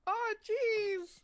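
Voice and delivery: squeaky startled oh jeez; high squeal. Real, not acted. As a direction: yelp in surprise. Player reacts to perception roll.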